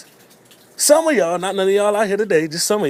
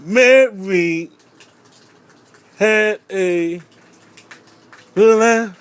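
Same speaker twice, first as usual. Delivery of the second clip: put-on louder stumbling kid recital; 3 semitones higher, 6 dB louder, much slower than usual. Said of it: "talking about".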